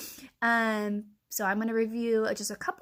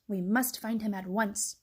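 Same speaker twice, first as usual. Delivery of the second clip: usual speaking voice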